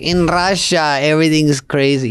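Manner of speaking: Yakov Smirnoff impression, Russian accent; a touch of a Russian accent. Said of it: "Yakov Smirnoff was like".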